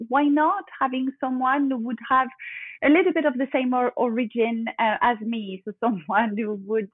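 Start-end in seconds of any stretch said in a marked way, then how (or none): none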